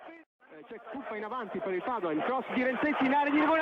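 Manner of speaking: ordinary delivery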